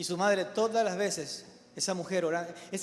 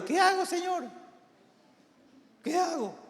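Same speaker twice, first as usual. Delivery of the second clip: imploring voice pitched way up; pleading prayer; 12 semitones higher than usual. Retold or imitated. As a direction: re-enact crying out for guidance. Acts his desperate prayer to God.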